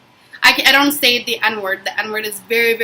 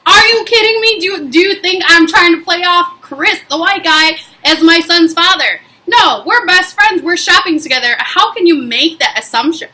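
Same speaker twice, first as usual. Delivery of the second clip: higher-pitched, emphatic outrage; indignant; 4 semitones higher than usual. Retold or imitated. Answering strangers who assumed Chris is the father, indignant. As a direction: fire back in disbelief, opening high and easing off.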